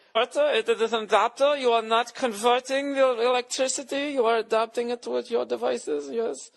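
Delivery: put-on high, loud lilting Scandinavian accent; mock Scandinavian accent. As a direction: pedantic Scandinavian techie correcting him. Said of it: "Oh, fuck you, Hans".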